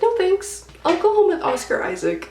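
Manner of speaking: high, loud sassy brush-off; sassy refusal. Retold or imitated. Vlogger rejecting the men, high sassy brush-off. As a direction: turn them down with sass.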